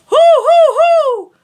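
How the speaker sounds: loud ringing high hoo; full high head tone. Shown as a demonstration. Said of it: "and head voice".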